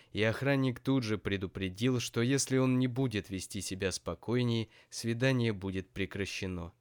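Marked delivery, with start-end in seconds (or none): none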